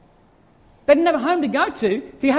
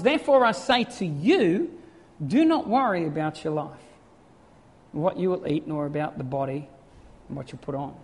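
gentle reading; 9 semitones lower than usual. A narrator's reading. Reading Jesus' words gently.

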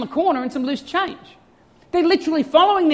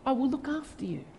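tender reassurance; 3 semitones higher, 5 dB quieter than usual. Retold or imitated. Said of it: "He says".

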